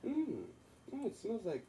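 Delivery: high-pitched imitation of a customer sniffing around; nosy customer. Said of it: "talking to a customer of mine".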